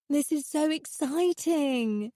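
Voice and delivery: high, slow, thrilled squeal; excited squeal. A narrator's reading. Miranda to the artist, squealing with excitement. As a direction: squeal it like a giddy girl. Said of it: "she squealed".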